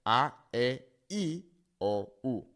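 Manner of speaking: A, E, I, O, U as ah, eh, ee, oh, oo; A, E, I, O, U one by one with I as ee; Spanish-sounding A, E, I, O, U; Spanish vowels. Shown as a demonstration. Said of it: "Remember that the I in Spanish makes that E sound".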